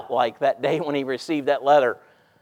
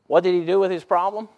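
ordinary talk, nothing marked

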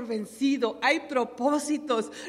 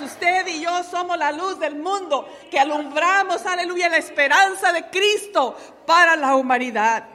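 preaching at full force; 3 semitones higher, 5 dB louder than usual. Acted. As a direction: lift the church with each line. Preaching climax on the light of the world. (predicación a todo pulmón, fuerte y pausada)

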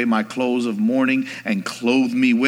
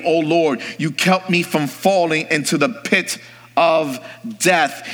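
ordinary talk, nothing marked

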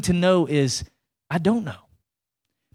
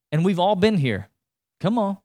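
normal talking voice

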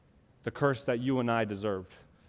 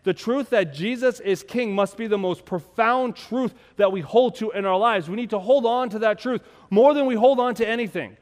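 loud, high preaching voice; building sermon climax; fiery preaching; 9 semitones higher, 8 dB louder than usual. Acted. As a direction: preach it with full force. Preaching at full force.

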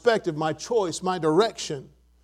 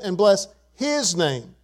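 slow, with his set apart; stress on his; slower than usual. Shown as a demonstration. Preacher closing the verse for congregation, his emphasized. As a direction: pause and lean on his. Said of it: "I'm gonna emphasize the word his".

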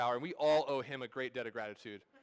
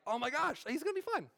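high, rushed realization; excited relief; 11 semitones higher than usual. Retold or imitated. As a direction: gasp out the relief like a fan. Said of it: "And I was like".

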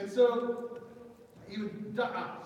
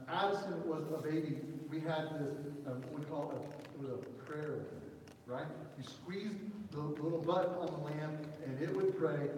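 normal talking voice

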